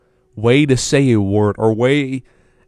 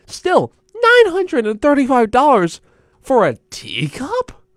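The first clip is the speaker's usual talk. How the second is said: high, drawn-out disbelief; incredulous; 4 dB louder, slower than usual. Real, not acted. Host balks at the price of a teacup, incredulous. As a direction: stretch it out, pitched way up in disbelief.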